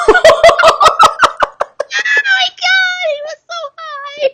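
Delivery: very high, loud cackling laugh; shrieking laugh. Real, not acted. Mother retelling her drugged son's antics, shrieking with laughter. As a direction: laugh so hard the voice squeaks. Cracking up over drugged son.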